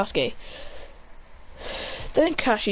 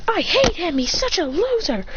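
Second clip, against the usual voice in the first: high, petulant acted outburst; mock anger; 8 semitones higher than usual. Rival venting about the boy who fought him, high mock anger. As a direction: vent with mild mock fury, pitched up.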